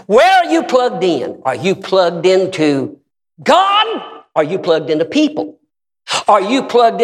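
high, loud, slow demand; preaching challenge. Real, not acted. Preacher challenging the congregation, high and loud. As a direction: press the question hard.

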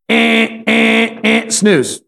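flat, high repeated electronic beeps; imitated alarm buzzer. Preacher imitating his roommate's early alarm, buzzer-like beeps. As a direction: blare like an alarm clock, evenly spaced.

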